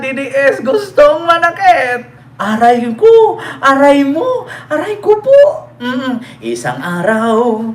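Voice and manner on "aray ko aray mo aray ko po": hammed-up whimpering ouch, rising to a high wail; mock crying out. Vlogger mocking angry supporters with hammed-up wails. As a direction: wail like a hurt supporter in jest.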